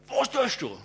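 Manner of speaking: shouted high and loud; angry yell. Retold or imitated. Owner confronts the photographer again, angry shout. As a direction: bark the question at him. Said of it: "Dann sagt er".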